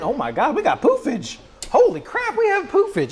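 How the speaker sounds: very high, laughing delight; giddy high exclamation; excited squeal. Real, not acted. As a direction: shriek with joy at the result. Eggs puffing up in the pan.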